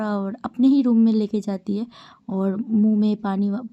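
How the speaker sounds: ordinary delivery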